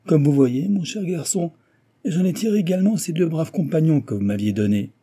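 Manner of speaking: high-pitched, breezy show of courtesy; mocking engineer. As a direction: taunt a rival with airy good cheer. Engineer's cheerful taunting answer.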